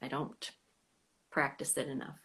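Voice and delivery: ordinary delivery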